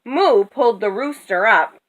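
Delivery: plain reading voice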